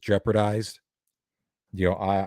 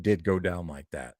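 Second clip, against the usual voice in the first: ordinary talk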